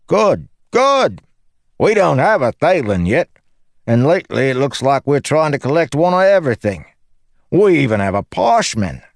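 high, eager joker's voice; excitable cocky recruiter; cheeky Lopen, delighted. Acted. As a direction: welcome the newcomer with gleeful, high-pitched chatter. Lopen's dialogue in narrated story.

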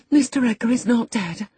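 high, horrified gasp of words. A narrator's reading. Housekeeper hears of the squire's funeral, gasping in alarm. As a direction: gasp it out in dread. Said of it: "I gasped".

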